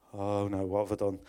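a low, quiet mock groan of dread; lightly voiced schoolboy dread, said low and quiet. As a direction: mutter it low like you're in trouble. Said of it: "that fear comes back".

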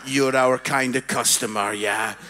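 very low, level mock staff voice; approving shop staff. Lidl staff welcoming an odd customer, low approving voice. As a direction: drop right down low and approve knowingly.

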